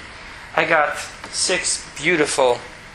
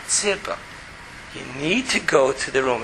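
much lower, softer, calm and even; tone brought down; 6 semitones lower, 7 dB quieter than usual. Shown as a demonstration. Father telling his daughter to clean her room, low and calm. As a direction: keep it low and steady, no yelling. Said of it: "bring your tone really down".

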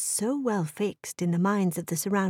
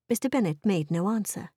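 ordinary delivery, nothing marked

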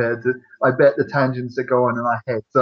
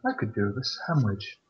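bored inner thought; 5 semitones lower, 10 dB quieter than usual. Retold or imitated. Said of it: "and you're just going".

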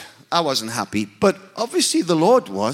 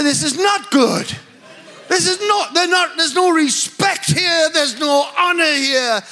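raised, higher voice, shocked at no respect; indignant outburst; 6 semitones higher than usual. Retold or imitated. Indignant at casual worship leader.

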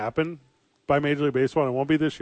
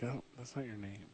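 mock quiet aside; 3 semitones lower, 19 dB quieter than usual. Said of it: "You're just like".

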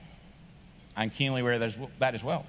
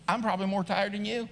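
softer, unhurried reply with pauses; buddy-to-buddy; 3 semitones higher, 4 dB quieter than usual. Acted. Kirk gently setting his tired friend Chuck straight, buddy-to-buddy. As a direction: reason with him quietly, as a pal. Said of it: "Maybe he says it like this".

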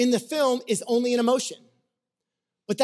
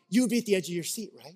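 ordinary talk, nothing marked

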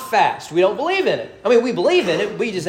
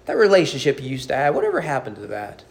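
ordinary talk

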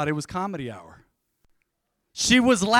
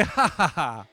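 ha ha ha breaking into actual laughter; a fuller, genuine ha ha ha; real laugh; 3 semitones lower, slower than usual. Shown as a demonstration. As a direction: laugh it out properly this time. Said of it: "You could do better than that".

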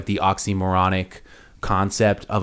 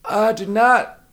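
high, over enunciating Clinton voice; Bill Clinton impression; 10 semitones higher, slower than usual. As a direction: stretch each word as Clinton. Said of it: "[name], you do Bill".

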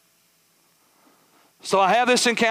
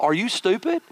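higher, exasperated thoughts, mock disbelief; incredulous inner voice; 6 semitones higher, slower than usual. Pastor mocking Brother Curtis's advice, exasperated inner disbelief. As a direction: scoff inwardly at the praise. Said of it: "I thought in the back of my head".

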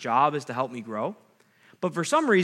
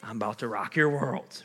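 low, hushed mock glee; smirking, giddy glee; 4 semitones lower, 5 dB quieter than usual. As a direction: tease softly, as if sitting on a happy secret. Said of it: "smirked and got a little giddy".

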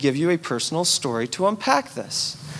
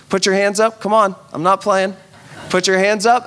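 louder commanding call; leading exercises; 5 dB louder than usual. Real, not acted. Pastor making sleepy congregation stretch, loud command. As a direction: get the room moving, louder. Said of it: "do these exercises with me".

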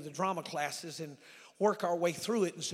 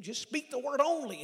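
higher, louder urging; insistent plea; 9 semitones higher, 9 dB louder than usual. Acted. Centurion urging Jesus to heal by word, insistent. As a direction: press the plea with a raised voice.

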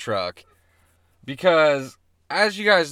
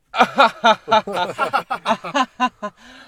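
high loud put-on laugh; hammed-up posh chuckle; snobby fake laugh; 9 semitones higher, 7 dB louder than usual. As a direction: laugh like a rich old snob at a party. Mock rich snob laughter.

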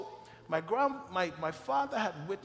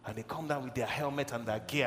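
ordinary talk, nothing marked

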